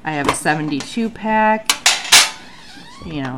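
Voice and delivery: ordinary talk, nothing marked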